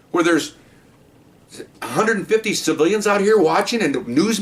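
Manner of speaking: high, pleading cop voice begging him to stop; mock pleading officer. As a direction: act out the officer begging the bomber. Officer's plan acted out, pleading.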